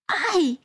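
high yelp, pinched; short high ouch; sharp cry of pain. A narrator's reading. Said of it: "krzyknęła głośno Tania".

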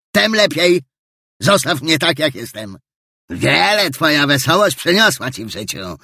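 curt Scrooge. Acted. Scrooge brushes nephew off.

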